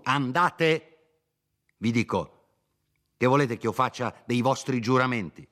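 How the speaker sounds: angry shouting lawyer. Acted. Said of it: "sempre gridando". (il dottore che grida indignato)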